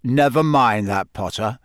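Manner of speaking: gruff, growled brush-off; growling. A narrator's reading. Moody overriding Harry's excuse about class, growling. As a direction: growl the objection aside. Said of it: "he growled".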